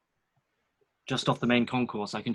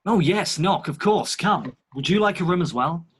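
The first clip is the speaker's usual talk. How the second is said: gracious host; 6 semitones higher, 4 dB louder than usual. Acted. Valentine hosting in character.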